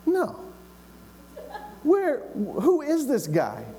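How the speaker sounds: high-pitched, stubborn refusal; refuse, then demand again in a high voice; high, incredulous insistence; insistent young David. Acted.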